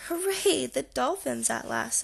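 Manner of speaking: lightly excited cheer, relieved at last; mild cheer. A narrator's reading. Sister finally gets the dolphin show, mildly cheering.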